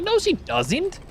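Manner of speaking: retorting. Retold or imitated. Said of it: "I'm like".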